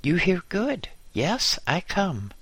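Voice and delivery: high, mild shriek; high excited call into a microphone; Marcel. A narrator's reading. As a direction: shriek eagerly, pitched well up. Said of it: "and shrieked into the microphone".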